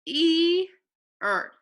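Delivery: E-er with a long stretched E sound, as in ease. Shown as a demonstration. Teacher sounding out the E sound for her class, stretched. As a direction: sound out E-er with a clear long E like in ski. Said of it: "what sound is the I making in ease".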